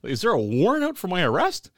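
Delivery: high-pitched nervous what-ifs; mock paranoid worry. Real, not acted. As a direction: act half-spooked, voice up high. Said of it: "I'm thinking".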